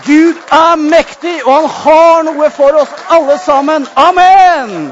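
slow, loud preaching cry; fervent proclamation. Real, not acted. Preacher lifting the congregation with a slow, loud proclamation.

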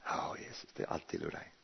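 soft unvoiced whisper of surrender. Preacher answering Jesus, whispered and surrendering. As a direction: give it up in a breath.

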